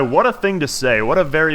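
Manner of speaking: ordinary delivery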